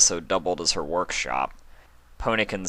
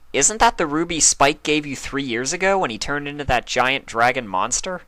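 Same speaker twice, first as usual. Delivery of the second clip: Twilight voice; 8 semitones higher, 4 dB louder than usual. A narrator's reading. Twilight asks.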